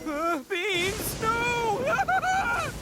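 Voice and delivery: high, rising yelp of panic at the beans; panicked cry. Acted. Itto sees the beans and cries out in panic. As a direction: recoil from the beans in alarm.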